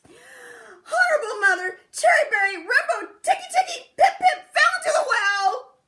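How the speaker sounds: very high, very loud sobbing shout, tripping over the name; a frantic boy crying out the jumbled name; panicked crying child. Acted. Chang garbling name in panic.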